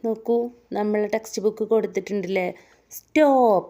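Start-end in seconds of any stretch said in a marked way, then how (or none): 3.0-3.7 high, loud barked stop; shouted stop. Acted. Teacher reading the police officer's shout from the textbook. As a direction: command the traffic to halt. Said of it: "shout the police officer".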